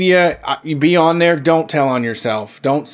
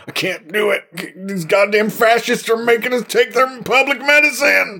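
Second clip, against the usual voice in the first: high, far-off put-on anti-vax voice; mocking anti-vaxxer; 9 semitones higher than usual. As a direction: sneer like an outraged conspiracy nut.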